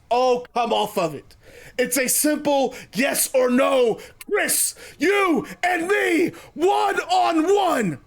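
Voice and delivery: lightly acted challenger, rising to high, slow words; Ospreay, impatient challenge. Wrestler demands a match, lightly acted impatience building slowly.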